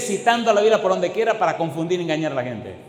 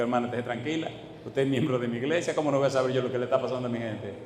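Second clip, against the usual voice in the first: lower, softer pastoral voice; calm reassurance; 5 semitones lower, 6 dB quieter, much faster than usual. Retold or imitated. Pastor retells reassuring a worried church member, low and calm. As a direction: reassure quickly in a low, soft voice.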